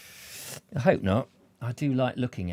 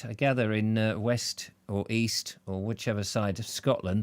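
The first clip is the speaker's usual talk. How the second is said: usual speaking voice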